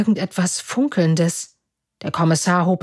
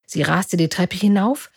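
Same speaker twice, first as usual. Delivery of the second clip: ordinary delivery, nothing marked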